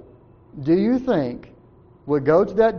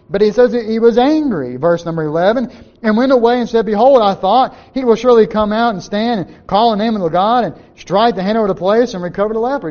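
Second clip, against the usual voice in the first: raised, louder, reading the verse out with fire; preaching at full force; 4 semitones higher, 6 dB louder than usual. Acted. Preacher reading Naaman's angry words to the church, at full force. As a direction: proclaim it from the pulpit.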